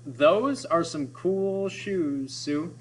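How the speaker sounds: very slow, stretching every oo sound; drawn-out oo. Shown as a demonstration. Teacher reading the cool shoes line slowly to the class. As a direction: savour each oo in the line. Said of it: "Check this one".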